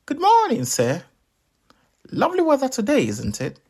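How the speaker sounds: very high, chirpy and loud; high squeaky melon greeting; tiny melon voice. Acted. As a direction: chirp politely like a talking melon. Anansi pretends to be a melon.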